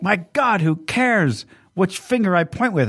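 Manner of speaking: high, outraged ranting voice; mock exasperated dictator; Hitler parody voice. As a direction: rant up high like a furious dictator. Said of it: "he's like".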